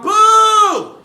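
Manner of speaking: high loud heckler Boo!; mock boo. Heckler at his first New York set, loud high boo. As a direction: yell it like a heckler. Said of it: "she didn't scream out".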